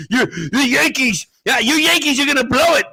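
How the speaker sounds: high, fast needling jab; taunting radio host. Retold or imitated. Radio host ribbing his partner about the Yankees. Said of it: "And be like".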